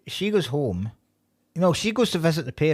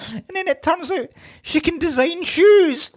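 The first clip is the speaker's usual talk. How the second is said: laughing incredulously. Real, not acted. Telling co-host the absurd shoe plot, laughing hard. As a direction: crack up while telling it.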